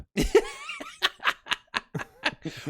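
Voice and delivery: high loud real laugh; burst of laughter. Real, not acted. Listener cracking up at the Big Dipper joke.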